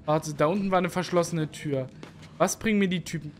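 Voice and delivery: usual speaking voice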